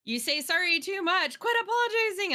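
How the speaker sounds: high-pitched retold telling-off; friend's scolding. Quoting friend scolding her.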